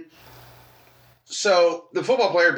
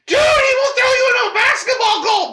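loud, high panicked warning; alarmed shout; 5 dB louder than usual. Retold or imitated. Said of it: "I'm like".

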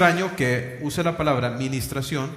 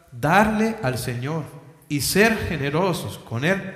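usual speaking voice

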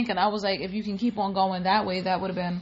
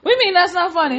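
mock indignation; 8 semitones higher, 8 dB louder, much faster than usual.

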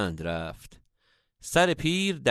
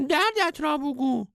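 very high, piping wise-man voice; comic falsetto old master; squeaky old sage. A narrator's reading. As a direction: play the sage as a squeaky cartoon guru.

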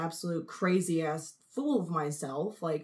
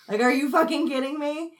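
exasperated; 9 semitones higher, 8 dB louder than usual. Real, not acted. Exasperated at herself.

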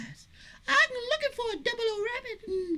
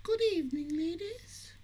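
low, soft, formal greeting; put-on suave host; 8 semitones lower, 7 dB quieter than usual. Boy spy greets two visitors, put-on suave host. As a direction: play the smooth spy receiving guests. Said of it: "why are you talking like that?".

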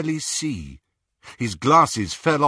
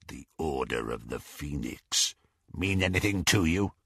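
gloating werewolf; 5 semitones lower, 6 dB quieter than usual. Acted. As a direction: savour every word like prey. Greyback presses.

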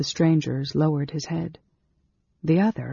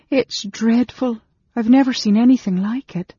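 gasping between sobs; 8 semitones higher, 6 dB louder than usual. A narrator's reading. Servant girl reassuring the innkeeper, gasped between sobs. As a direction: act a sobbing girl, pitched way up. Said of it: "gasp between sobs".

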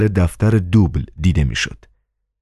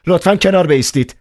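angry; 11 semitones higher, faster than usual. Acted. Angry doorwoman orders the two aside, higher and fast. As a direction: order them aside sharply. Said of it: "با حالتی عصبانی گفت".

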